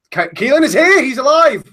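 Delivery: loud and high, cheering him in; excited welcome. Real, not acted. Hosts cheering the returning co-host, excited loud welcome. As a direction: hail a friend back from the dead. Greeting a missing friend.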